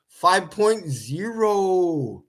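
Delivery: "Five point zero." spelled out slowly, each word separate; slow and deliberate. Shown as a demonstration. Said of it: "Why are we putting letters in replacements of numbers?".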